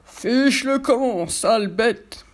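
loud, very slow menacing giant; shouting giant. A narrator's reading. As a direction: bellow it like a brute. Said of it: "crie le molosse".

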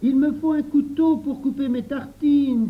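put-on loud, high boyish call; high, insistent child character; shouted demand. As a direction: holler for what you need. Louis shouts an order.

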